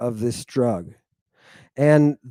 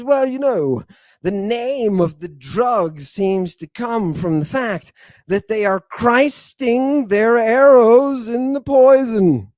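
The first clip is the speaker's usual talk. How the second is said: quoting the physician; 7 semitones higher, 5 dB louder than usual. Retold or imitated. Lecturer voicing Paul of Aegina's text, a hint of a learned doctor. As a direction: read the old physician's words like a pronouncement. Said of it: "And he says".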